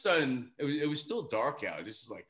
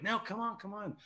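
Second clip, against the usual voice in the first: a hint of a higher coaxing voice; insisting [name]; 8 semitones higher, 4 dB quieter than usual. [name] coaxing shy kids along, lightly raised voice. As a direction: coax them lightly, pitch up.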